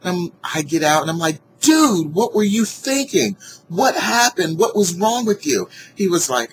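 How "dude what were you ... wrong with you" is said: high, loud, incredulous scolding of the driver; angry driver shouting at the man who hit her car; exasperated yell. Retold or imitated. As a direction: let him have it, voice up high and loud. Confronting the other driver, retold.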